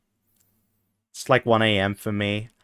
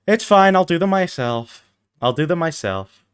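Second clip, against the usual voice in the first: ordinary talk, nothing marked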